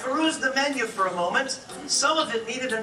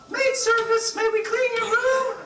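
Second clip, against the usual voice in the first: very high, louder chirpy maid; 13 semitones higher, 4 dB louder than usual. Acted. Maid at the guest's door, high chirpy voice.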